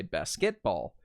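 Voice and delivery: basketball wrongly accented on KET; stress on middle syllable. Shown as a demonstration. Teacher shows how wrong stress sounds, basketball stressed on KET. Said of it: "sounds completely different if I were to say".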